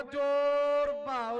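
slow, loud stretched shout; loud drawn-out call. Real, not acted. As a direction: stretch it out over the crowd. Raider struggles, commentator shouts.

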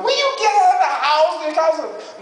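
high, rapid panicked yelling; hammed-up frantic nagging, voice way up; frantic mom. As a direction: act out a mother shrieking urgent orders. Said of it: "Mom will be going there frantically going".

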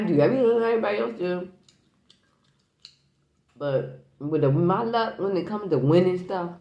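chewing while talking; talking between bites; mouth full of crab. Real, not acted. Seen 0: puts crab meat in mouth and chews mid-sentence, around 1.5-3.8s.

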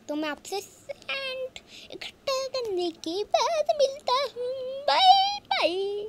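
high-pitched, loud sign-off; playful high yelled farewell; hammed-up frantic goodbye. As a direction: shriek your goodbye to the viewers, dragging out the last bye. Hurried goodbye to viewers in panic.

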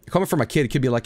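ordinary delivery

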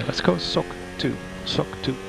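usual speaking voice

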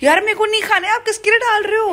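high, loud whining refusal; high protest. Real, not acted. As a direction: push the food away, voice up high. Refusing food from sister.

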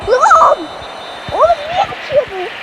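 high, loud cry of disbelief; frustrated yell. Real, not acted. Gamer yelling as the match ends too soon, loud. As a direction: shout at the game as it ends.

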